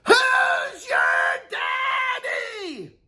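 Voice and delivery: high, slow, drawn-out victory yell; triumphant yell. Pretend. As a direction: roar it like a champion. Triumphant champion call.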